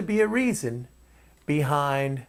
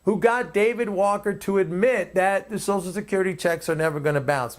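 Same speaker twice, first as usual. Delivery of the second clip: ordinary delivery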